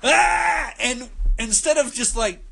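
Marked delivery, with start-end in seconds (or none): 0.0-0.7 short high mock scream; startled yell. Retelling Squirrel turning to see Ivan, high startled mock yell. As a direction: yelp in shock as Squirrel. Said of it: "Squirrel turns and goes".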